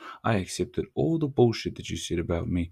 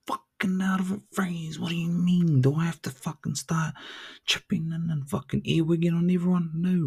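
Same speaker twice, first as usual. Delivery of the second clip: higher put-on voice of a doubting listener; mock listener; 8 semitones higher than usual. Imagined listener P questioning him, higher mock voice. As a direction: play the sceptic asking back.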